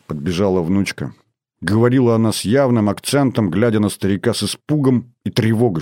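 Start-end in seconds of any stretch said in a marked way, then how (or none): none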